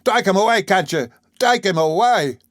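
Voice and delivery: high, loud panicked pleading; cry for help. A narrator's reading. Beaten cook begging the onlookers to save him, high and loud. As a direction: yell for someone to pull him off. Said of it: "he cried".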